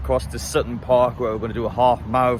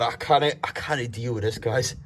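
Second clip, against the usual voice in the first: usual speaking voice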